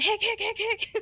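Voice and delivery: high, loud playful hiccup noises; imitating hiccups. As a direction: copy a chorus of hiccups. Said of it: "Everyone just immediately went".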